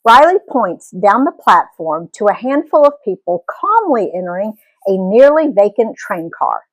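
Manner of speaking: ordinary delivery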